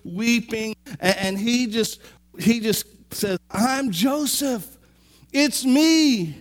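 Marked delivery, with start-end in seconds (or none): 3.5-6.4 slow, higher voice breaking open; emotional reveal. Retold or imitated. Joseph reveals himself to his brothers, slow and higher. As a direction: say it slowly, rising with emotion.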